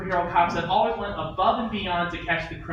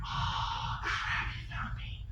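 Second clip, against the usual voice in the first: whispered under the breath; hushed panic; 11 dB quieter, much slower than usual. Acted. Caught by the captain, a panicked whisper to himself.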